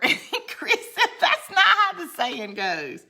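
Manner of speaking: high, loud, slow laughing retelling. Real, not acted. Woman retelling Chris's correction of her saying, laughing. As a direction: laugh through the retold comeback.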